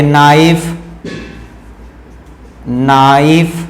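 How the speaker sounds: नाइफ slowly drawn out from the n; silent-k model. Shown as a demonstration. Teacher models the word carefully, silent-k model. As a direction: stretch नाइफ with no k sound. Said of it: "इसको नाइफ पढ़ते हैं".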